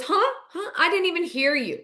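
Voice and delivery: high-pitched innocent huh; mock innocent confusion; feigned cluelessness. [name] feigns not hearing.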